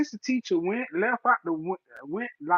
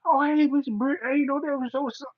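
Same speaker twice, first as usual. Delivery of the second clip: fawning cop; 8 semitones higher, faster than usual. Retold or imitated.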